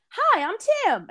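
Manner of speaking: high, bright-voiced imitation of a Southern accent greeting. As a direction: lightly mimic a friendly Southern accent saying hi. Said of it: "in his little Southern accent".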